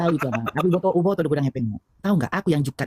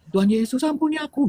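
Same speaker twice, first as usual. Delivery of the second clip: higher-pitched imitated cry for mercy; retold tearful plea; 10 semitones higher than usual. Woman retelling her prayer to Jesus, mildly tearful pleading. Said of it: "aku sampai nangis-nangis".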